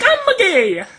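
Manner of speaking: Mario-style voice. Retold or imitated. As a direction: squeak it like a video game plumber. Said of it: "they talk like Mario".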